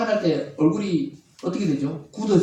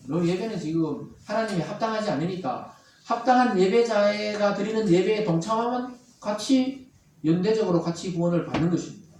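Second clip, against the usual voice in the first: usual speaking voice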